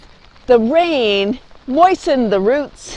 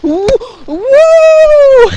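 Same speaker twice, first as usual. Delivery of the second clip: high loud whoop; joyful loud woo; excited whoop; 12 semitones higher, 8 dB louder than usual. Real, not acted. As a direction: shout out with joy. Whoops flinging umbrella.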